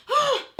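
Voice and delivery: a sudden high gasp; sharp mock gasp. Gasp tag alone.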